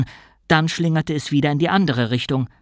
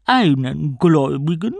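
louder, mocking sea queen; evil queen; 2 semitones higher, 5 dB louder than usual. A narrator's reading. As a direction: scoff at the tiny god. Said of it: "sagte die Königin des Meeres".

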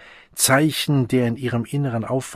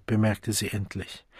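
ordinary delivery

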